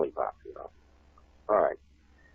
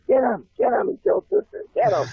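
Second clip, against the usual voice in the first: very high mock yell of a kid mob; bullies yelling; 3 dB louder than usual. Bullies chasing him down the hallway, high mock yells. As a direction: shriek it like a charging pack of kids. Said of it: "I could hear 'em screaming, yelling".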